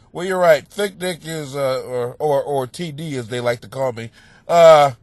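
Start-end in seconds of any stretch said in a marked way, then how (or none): none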